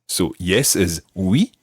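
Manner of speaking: slow, careful model phrase; slow teaching pace. Shown as a demonstration. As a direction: spell it out slowly for learners. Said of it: "slow model for learners".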